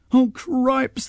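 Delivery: put-on high dismayed boy's exclamation; a boy caught out, oh no. A narrator's reading. Sean sees the wheel spin off, dismayed. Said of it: "thought Sean".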